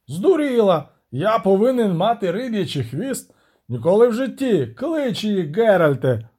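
high, indignant outburst; an incredulous prince erupting, voice jumping up; outraged prince. Acted. Prince rejects the siren's tail demand, outraged.

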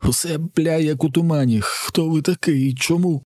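high, tearful, bewildered pleading; sobbing. A narrator's reading. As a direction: whimper the questions in a high voice. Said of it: "схлипує Джек". (плаксиво й розгублено, високим голосом)

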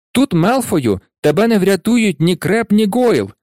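high, spirited taunt; calling out. A narrator's reading. Harry challenging Malfoy without his cronies, calling out. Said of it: "вигукнув Гаррі".

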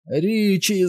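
very high, stretched-out cry; excited yell, pitch soaring; shouted name. A narrator's reading. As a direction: cry out his name in alarm. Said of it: "закричав Біл".

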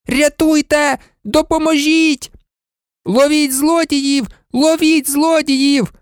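high desperate cries, slow on the last calls; shouting for help. A narrator's reading. Boy at the shop window calling for help, high shouts. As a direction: yell it to wake the whole street. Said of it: "закричав".

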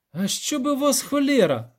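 high-pitched exasperated outburst; grumbled curse. A narrator's reading. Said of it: "буркнув Любисток".

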